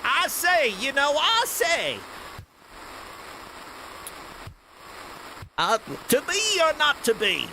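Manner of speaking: high, slow mock Texan drawl; hammed-up Texan Shakespeare. Joking how Shakespeare's actors might sound, mock Texan drawl. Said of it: "people in Shakespeare's time being like".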